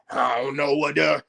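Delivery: a hint of the rival's fake voice; mocking put-on voice. Critic imitates rival's put-on voice, lightly mocking. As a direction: mimic his affected tone, mocking. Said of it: "why are you putting that voice on?".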